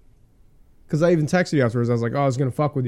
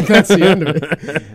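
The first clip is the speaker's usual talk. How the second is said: laughing; 6 semitones higher, 8 dB louder, slower than usual. Real, not acted. Laughing at the recording.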